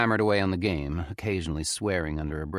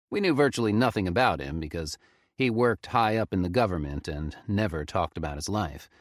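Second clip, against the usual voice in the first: ordinary delivery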